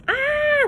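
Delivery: high sharp scream of fright; terrified scream. A narrator's reading. The boys screaming at the slimy head, terrified scream. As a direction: scream as if you saw a monster. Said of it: "they screamed".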